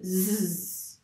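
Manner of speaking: Z as a buzzing zzz; Z sound. Shown as a demonstration. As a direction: hold the buzz on Z. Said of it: "on prononce bien ce S". (le son Z bien appuyé)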